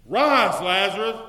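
loud ringing command; booming "Rise, Lazarus"; commanding call. Acted. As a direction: call him out of the tomb. Voicing Jesus calling Lazarus.

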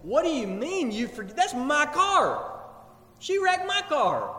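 acted-out outrage, climbing in pitch; baffled protest. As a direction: protest in disbelief as the wronged owner. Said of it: "Kelsey's standing off in the corner, scratching her head, saying".

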